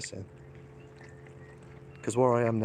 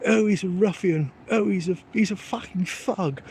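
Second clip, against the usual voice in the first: high, loud sneering posh voice; hammed-up posh disapproval; mock posh neighbours; 9 semitones higher, 5 dB louder than usual. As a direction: put on a snooty neighbour's disdain. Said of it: "I'm sure they're thinking, like".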